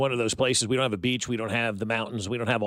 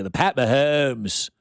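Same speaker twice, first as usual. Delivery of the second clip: put-on Mid-Atlantic accent; 3 dB louder, slower than usual. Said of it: "that Mid-Atlantic accent".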